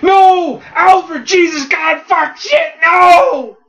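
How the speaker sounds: loud, high anguished shouting; horrified screaming. Acted. Robins seeing Alfred's throat cut open, horrified screaming. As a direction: scream each word in frantic horror.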